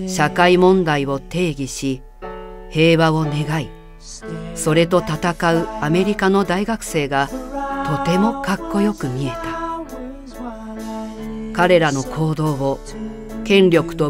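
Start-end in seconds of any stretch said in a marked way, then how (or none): none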